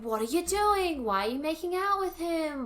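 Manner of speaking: mock-confront someone, voice lifted; mock scolding. Acted confrontation between the sisters.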